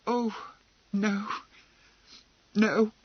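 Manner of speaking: put-on a frightened girl fumbling for words; Emily stammering out a nervous denial. A narrator's reading. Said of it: "Emily stammered".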